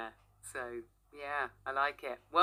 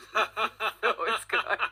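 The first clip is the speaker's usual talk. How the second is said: laughing; 4 semitones higher, 4 dB louder, much slower than usual. Real, not acted. Laughing at own puns.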